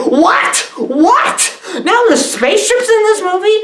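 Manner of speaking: hammed-up shock that the movie suddenly has spaceships; mock disbelief. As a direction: sell the shock of spaceships, laughing.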